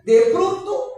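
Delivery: high, loud jolt; sudden loud burst. Real, not acted. Preacher jolting the congregation, loud and sudden. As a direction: break in like an interruption.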